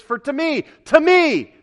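high, insistent cry as Paul; emphatic preacher's exclamation; singularly focused. Acted. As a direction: build to a loud, high repeat. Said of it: "he is singularly focused. He says".

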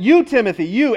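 "You" punched high and loud; stress on YOU. Shown as a demonstration. Preacher stressing whom Paul addresses, loud stress on "you". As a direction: hit both "you"s hard.

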